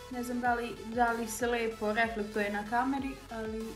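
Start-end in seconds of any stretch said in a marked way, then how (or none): none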